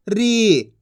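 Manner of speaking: री with a held ī; long drawn-out vowel. Shown as a demonstration. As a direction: stretch री into a long "reee". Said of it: "खींच के पढ़ा जाता है".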